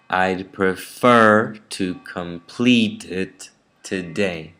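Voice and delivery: slow model sentence, leaning hard on "prefer", "complete", "today" second syllables; exaggerated sentence stress. Shown as a demonstration. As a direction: stretch FER, PLEET, DAY and keep the rest weak. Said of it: "Let me say it again".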